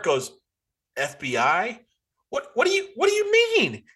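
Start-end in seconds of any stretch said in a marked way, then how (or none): 2.3-3.8 high-pitched disbelief at the sheriff's question; much higher, baffled what-do-you-mean; incredulous. Real, not acted. Podcaster mocking a movie sheriff, high incredulous disbelief.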